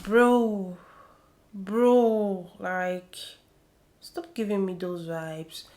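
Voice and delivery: exasperated call. Real, not acted. Exasperated address to Swanky.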